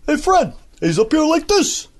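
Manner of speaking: high, bouncy Carney-style sidekick. Retold or imitated. Showing his high Barney Rubble version. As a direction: lift it up into a cheery sidekick. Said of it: "a higher version, you know, like this".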